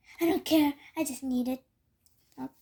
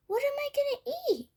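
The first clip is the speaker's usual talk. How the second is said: panicked wail; 11 semitones higher than usual. Acted. Cat panicking about closed shops.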